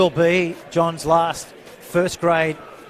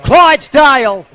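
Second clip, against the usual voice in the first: excited call; 9 semitones higher, 14 dB louder than usual. Real, not acted. Live call as player breaks.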